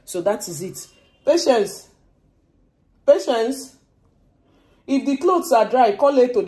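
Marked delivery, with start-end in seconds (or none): 1.2-6.5 loud call to someone across the house. Real, not acted. Calling Patience to bring in the dry clothes, loud call.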